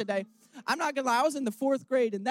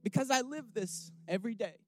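softer, choked-up words through tears; crying; 4 dB quieter than usual. Real, not acted. Preacher tells the congregation about going home, crying softly. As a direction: let the tears break through, quiet. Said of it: "I told you I'm gonna cry".

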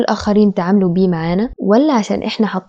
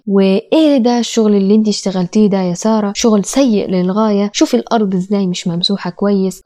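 usual speaking voice

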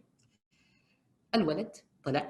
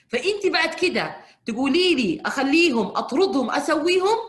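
mock exasperation; 10 semitones higher, 8 dB louder than usual. Mocking the mother's options.

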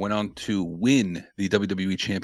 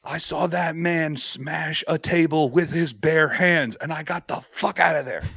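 Pat McAfee impression; 7 semitones higher, slower than usual. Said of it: "and he goes".

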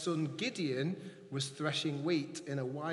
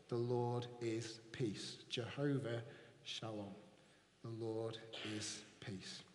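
reverent hush; 7 semitones lower, 9 dB quieter, slower than usual. Acted. Reverent naming of God's peace.

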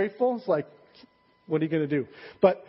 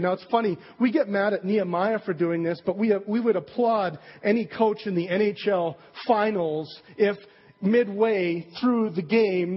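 ordinary talk, nothing marked

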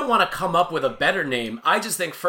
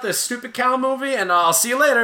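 mock huffy exit; 9 semitones higher, 4 dB louder than usual.

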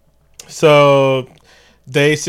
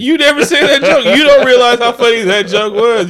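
much higher, louder exaggerated protest; mock outrage; 10 semitones higher, 8 dB louder than usual. Real, not acted. Complaining to cohost about stolen joke, hammed-up outrage. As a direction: ham up the indignation.

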